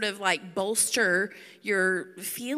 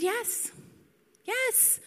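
high-pitched, softer mock-defiant yes; 12 semitones higher, 5 dB quieter than usual. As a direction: squeak out a petulant-prophet yes. Acts Jonah's defiant answer to God.

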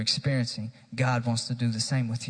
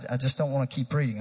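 ordinary talk

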